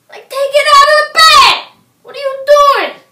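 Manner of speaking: loud, high shouting at her; exasperated yell. Acted. Exasperated outburst at woman.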